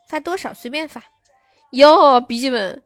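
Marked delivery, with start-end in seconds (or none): 1.7-2.8 loud, drawn-out exclamation; surprised exclamation. Real, not acted. Streamer reacting to a viewer's gift, loudly surprised. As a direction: burst out loudly and stretch the words.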